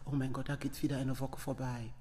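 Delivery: low, hushed, resigned; hopeless inner thought. Retold or imitated. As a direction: sigh it to yourself, losing hope. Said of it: "da war ich wieder hoffnungslos". (hoffnungslos, leise und tief vor sich hin)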